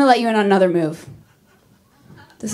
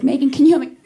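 mom calling; 6 semitones higher, 4 dB louder, much faster than usual. Retold or imitated. Mom calling daughter for help.

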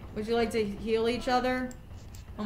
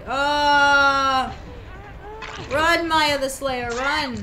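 startled; 4 semitones higher, 8 dB louder, much slower than usual. Real, not acted. Killer suddenly attacks.

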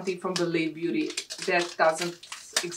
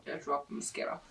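whispered; 3 semitones lower, 8 dB quieter, faster than usual. Real, not acted. Drops an item.

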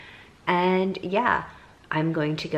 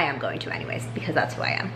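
breathy whispered confession; whispering; 7 semitones lower, 5 dB quieter, faster than usual. Real, not acted. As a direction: whisper it like a guilty secret. Whispered confession after pause.

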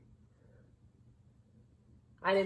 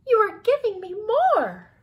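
loud, high protest; high-pitched, indignant complaint; exasperated mouse; 12 semitones higher, 9 dB louder than usual. A narrator's reading. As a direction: wail it as a fed-up mouse.

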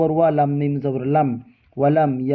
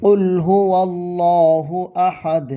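slow, melodic recitation of the verse; Quran recitation; 3 semitones higher, much slower than usual. Shown as a demonstration. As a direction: draw out each word in tajweed. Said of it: "কফ লাম পেশ কুল". (ধীর সুরেলা তিলাওয়াত)

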